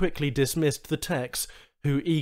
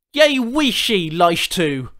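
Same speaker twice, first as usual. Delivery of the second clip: higher, louder furious insult; angry human swearing at the alien trader; 7 semitones higher, 9 dB louder, slower than usual. A narrator's reading. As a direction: hurl the worst insult he knows. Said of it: "unleashed the worst insult".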